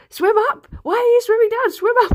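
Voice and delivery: high, loud mock yell; shouting. Teammates shouting orders at her while she swims, loud and high. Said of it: "shouting at me".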